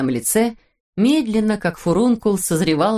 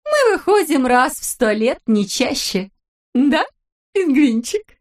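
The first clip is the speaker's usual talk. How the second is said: cooing wife; 7 semitones higher than usual. A narrator's reading. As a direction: coo at your husband. Wife character voice. (выше и громче, сюсюкая)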